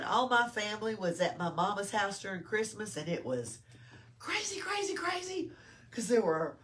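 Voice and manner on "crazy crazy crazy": high, sing-song crazy crazy crazy; playful high-pitched exasperation. Retold or imitated. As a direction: pitch it way up, mock frazzled. Mom describing hectic Christmas house.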